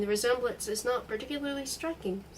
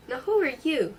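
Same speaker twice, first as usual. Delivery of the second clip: a raised, raspy demand, pitched up; high, hoarse voice; 4 semitones higher, 6 dB louder than usual. A narrator's reading. Startled host demanding who the intruder is, high and hoarse. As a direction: push the question out high and hoarse. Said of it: "he asked in a high, hoarse voice".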